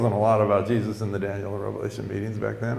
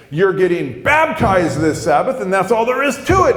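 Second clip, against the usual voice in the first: mad; 7 semitones higher, 4 dB louder than usual. Retold or imitated. Teacher slamming table at reluctant convert, mad. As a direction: imitate a man laying down the law. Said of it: "he gets mad at me".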